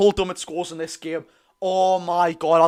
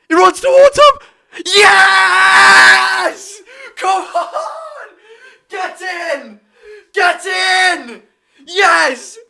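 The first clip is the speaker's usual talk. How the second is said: a cracking shriek of joy, very high and loud; screaming in ecstasy; 11 semitones higher, 7 dB louder, much slower than usual. Real, not acted. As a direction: lose it completely as the ball goes in. Fan screams at penalty goal.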